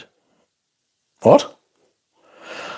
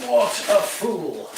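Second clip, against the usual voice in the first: mock self-scolding; 7 semitones higher, 7 dB quieter, much slower than usual. Shown as a demonstration. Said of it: "repeats in drawn-out voice".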